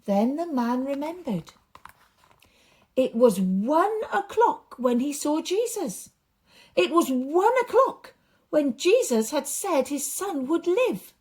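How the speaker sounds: lively, expressive storytelling voice; story narration for children. A narrator's reading. Storyteller reading a Bible story aloud to children, animated. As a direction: paint the story big for the kids.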